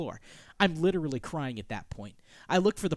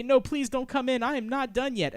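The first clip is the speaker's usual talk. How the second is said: high, raised pleading voice; 8 semitones higher, 4 dB louder than usual. Acted. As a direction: beg someone to stay outside. Acts pleading through the door.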